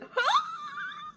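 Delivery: very high, trailing squeal of disbelief; high-pitched helpless squeal; high squealing laugh. Real, not acted. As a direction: let out a long thin shriek of laughter. Squeals after the mangled name.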